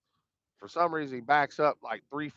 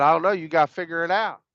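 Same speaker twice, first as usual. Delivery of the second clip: imitating an impatient grandpa, louder and higher; loud, higher-pitched mock brush-off; exasperated retort; 4 semitones higher, 7 dB louder, faster than usual. Grandpa refusing to help his grandkid drive, retold exasperated retort.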